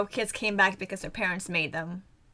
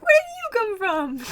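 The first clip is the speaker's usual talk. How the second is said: high, slow startled question; imitating a startled anime girl; mock startled question; 11 semitones higher, 5 dB louder than usual. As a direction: act out the character's surprise. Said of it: "she's like".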